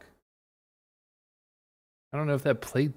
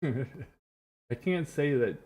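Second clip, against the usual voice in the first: slow words through real laughter; can't keep a straight face; laughing; much slower than usual. Real, not acted. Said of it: "sentence without laughing".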